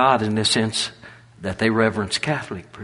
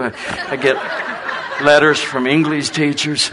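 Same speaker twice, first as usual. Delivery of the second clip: laughing; 5 semitones higher, 5 dB louder than usual. Real, not acted. Preacher laughs at his own English.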